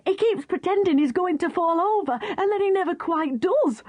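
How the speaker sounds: excited dog voice. Acted. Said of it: "panted Spotted Dog".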